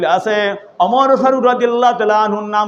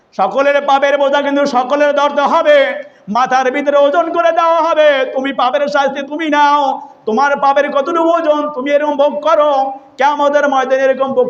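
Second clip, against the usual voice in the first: high chanted sermon warning; melodic preaching; 8 semitones higher than usual. Retold or imitated. Preacher voicing Omar warning his servant, melodic preaching.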